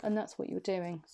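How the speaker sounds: normal talking voice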